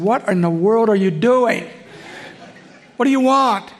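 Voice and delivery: a hint of an irritated dad, rising; impatient father snapping the question; annoyed father. Stern father catching son hovering.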